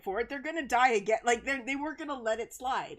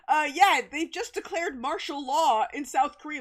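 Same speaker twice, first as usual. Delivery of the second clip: breaking the news; 6 semitones higher, 6 dB louder than usual. Retold or imitated.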